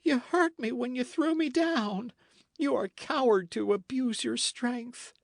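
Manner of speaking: sobbing. Acted. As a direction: accuse him through tears. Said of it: "sobbed Matcham".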